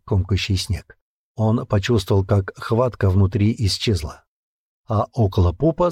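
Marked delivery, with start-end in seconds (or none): none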